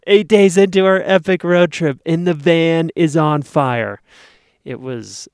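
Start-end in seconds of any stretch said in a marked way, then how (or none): 0.1-4.0 loud, raised voice of disbelief; dramatic exclaiming. Real, not acted. Storyteller announces the van fire on the road trip, dramatic exclaiming. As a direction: proclaim the disaster, high and loud.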